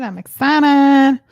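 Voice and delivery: "excited" stretched slow and loud; drawn-out excited cry. Shown as a demonstration. Host to live viewers, stretching "excited" out loud and slow.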